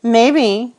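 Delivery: high and bright in Charlie's teasing voice; cheerful agreement. Acted. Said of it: "he agreed cheerfully".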